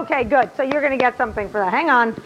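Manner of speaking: usual speaking voice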